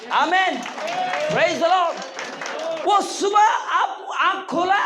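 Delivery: high, drawn-out shout of praise the Lord; preacher's shouted praise. Real, not acted. Preacher rallying the congregation, a long high shout. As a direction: call it out to the whole church.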